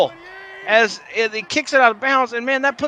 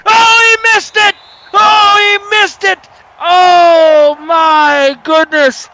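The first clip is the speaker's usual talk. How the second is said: anguished cry; 9 semitones higher, 8 dB louder, slower than usual. Pretend. Announcer despairing over the missed kick.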